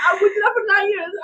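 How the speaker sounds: high and loud, half laughing, half wailing; laugh-crying. Real, not acted. Friend relating to a harsh mom, laugh-crying. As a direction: cry it out through laughter.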